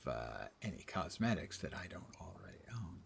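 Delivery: whispered aside; whispering. Real, not acted. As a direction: breathe it out unvoiced. Muttered aside.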